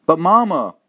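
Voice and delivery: high, loud desperate protest; anguished outcry; cried out. Acted. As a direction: burst out pleading to mother. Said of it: "I cried out".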